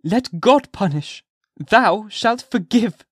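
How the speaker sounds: high, loud, very slow pleading; slow desperate plea. A narrator's reading. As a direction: drag out each word begging.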